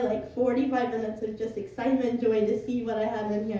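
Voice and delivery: ordinary talk, nothing marked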